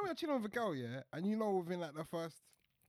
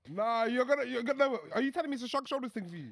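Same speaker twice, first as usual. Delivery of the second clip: louder, fast, pressing the question; excited; 5 semitones higher, 6 dB louder than usual. Real, not acted. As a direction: push him for an answer. Pressing friend, raised voice.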